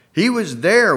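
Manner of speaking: high, loud accusing cry; cried out. A narrator's reading. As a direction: call it out, pointing at him. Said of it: "one woman cried out".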